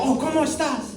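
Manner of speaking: high mock old Spanish lady greeting. Old Spanish woman greeting the comic, high and sweet. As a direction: chirp it like a sweet old abuela. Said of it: "where they go".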